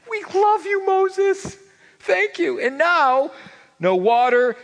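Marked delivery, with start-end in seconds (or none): 0.1-2.6 higher, mock-sweet thanks; a hint of fawning Israelites. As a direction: gush over the leader, half mocking. Israelites flattering Moses.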